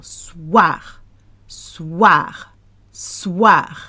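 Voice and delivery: "Soir" with a stretched wa in the middle; slow "Soir" as sss-wahr. Shown as a demonstration. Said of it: "You need that wa sound".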